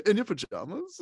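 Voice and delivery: high-pitched disbelieving question; incredulous homeowner. Retold or imitated. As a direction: question the flimsy excuse, pitch climbing. Homeowner doubts the agent's excuse.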